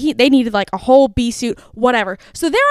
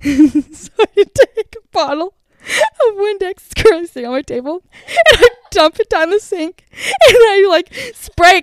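high-pitched, laughter-filled telling; laughing while telling; 7 semitones higher, 5 dB louder than usual. Real, not acted. Telling friend how she used the Windex bottle, laughing. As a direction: giggle your way through the confession.

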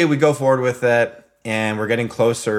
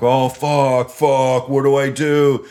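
panicked; 4 semitones higher, 6 dB louder, slower than usual. Retold or imitated. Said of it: "I'm like".

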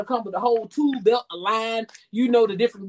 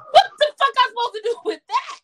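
indignant squeal at a lover who came unready; outraged high question; 11 semitones higher than usual. Real, not acted. Ranting to the podcast hosts, an outraged high-pitched question.

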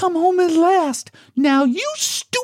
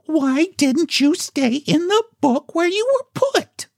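put-on much higher, scolding girl's voice; severe little girl. Girl scolding the animals, high and severe. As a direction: scold them like a stern child. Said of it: "said Jane Gladys severely".

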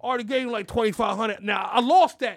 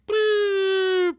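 high, louder cartoon "boop" noise; crash sound effect; 9 semitones higher, 5 dB louder than usual. Host miming the Bitcoin crash, high comic boop. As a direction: pop it like a falling chart.

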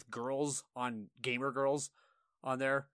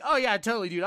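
high, loud over-eager agreeing; mock agreement; 7 semitones higher, 6 dB louder than usual. Player defusing a toxic opponent, mock agreeing. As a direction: agree far too readily, pitched up. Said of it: "just start agreeing with them".

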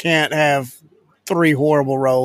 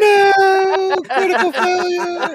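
excited shout; slower than usual. Real, not acted. Player sees critical failure roll.

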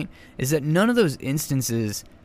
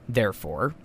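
ordinary talk, nothing marked

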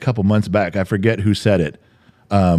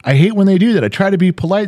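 fed-up outburst; 9 semitones higher, faster than usual. Real, not acted. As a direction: blurt it out, fed up. Ranting at boastful people.

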